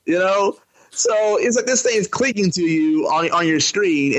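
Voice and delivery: ordinary delivery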